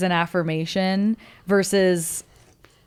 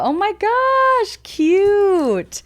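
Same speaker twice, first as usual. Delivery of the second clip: excited squeal; 11 semitones higher, 4 dB louder, much slower than usual. Real, not acted. Squealing over the cute board.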